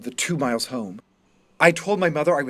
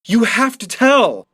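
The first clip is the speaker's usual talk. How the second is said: loud, high, slow furious yell; furious shout; 8 semitones higher, 9 dB louder, slower than usual. Acted. Student screams at the departing teacher in fury. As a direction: hurl it after her in rage. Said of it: "I shout after her".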